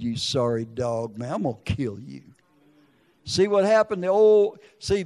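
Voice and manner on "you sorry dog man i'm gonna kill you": low menace. Retold or imitated. Grieving man to McVeigh on TV, low quiet threat. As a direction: say it under control, deadly serious.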